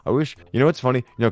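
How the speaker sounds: ordinary talk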